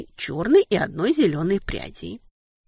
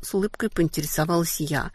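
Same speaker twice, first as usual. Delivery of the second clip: usual speaking voice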